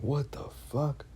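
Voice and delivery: hushed, high, drawn-out retold freak-out; mild retold panic, kept soft and slow; freaking out inwardly. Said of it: "freaking out in my head".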